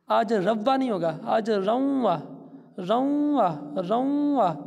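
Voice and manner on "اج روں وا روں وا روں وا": روں held through the nose before وا; nasal ghunna. Shown as a demonstration. Teacher drilling ghunna for students, nasal روں. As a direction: push the نون sound of روں into the nose. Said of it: "اس کی جو آواز ہے وہ ناک کے اندر جائے گی".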